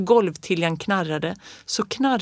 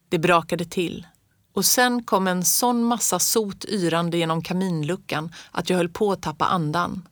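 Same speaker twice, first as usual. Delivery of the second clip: plain reading voice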